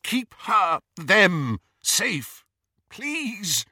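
high, pleading, breaking on please; desperate plea. Acted. Snape begging Dumbledore to save Lily, desperate high plea. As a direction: beg as if everything hangs on it.

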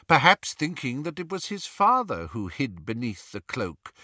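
usual speaking voice

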